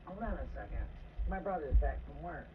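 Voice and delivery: speaking softly while watching the yard; hushed. Real, not acted. Keeps voice down, stranger in yard.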